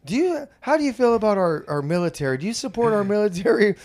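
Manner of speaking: earnest caller pitching to a stranger; girl's voice. Retold or imitated. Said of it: "now it's a girl's voice".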